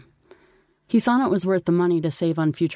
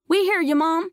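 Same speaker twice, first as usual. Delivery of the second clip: put-on very high-pitched boy's call; boy shouting; 12 semitones higher than usual. A narrator's reading. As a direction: call back down to mom. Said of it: "shouted Charlie".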